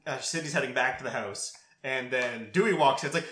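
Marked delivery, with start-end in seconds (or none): none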